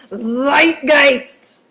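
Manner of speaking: loud, drawn-out goofy German accent; doofy German accent. Shown as a demonstration. Teacher showing students a fun word, doofy German accent. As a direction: belt it out in a silly German accent. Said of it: "say it with a, a doofy German accent".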